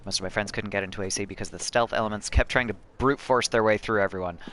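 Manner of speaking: ordinary delivery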